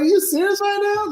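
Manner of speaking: high-pitched incredulous question; exaggerated, put-on high-voiced protest; mock disbelief. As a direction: squeak it in comic outrage. Repeats the retold disbelief.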